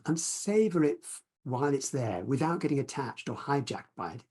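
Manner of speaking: ordinary talk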